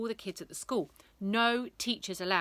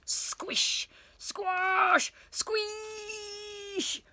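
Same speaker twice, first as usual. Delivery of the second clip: squishing sound words; 13 semitones higher, much slower than usual. A narrator's reading. Sound words as boy stuffed.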